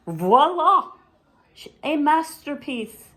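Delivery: high, loud triumphant reveal; big sister showing off her masterpiece; proud voila. Acted. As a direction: present it grandly. Zelda unveils her work.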